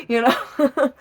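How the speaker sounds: high laughing you know. Real, not acted. Laughing at her own joke.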